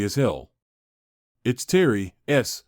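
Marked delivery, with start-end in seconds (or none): none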